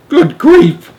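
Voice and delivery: very high, loud exclamation; shocked. A narrator's reading. Shanks realizes who is on the radio, stunned. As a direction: gasp it out in disbelief.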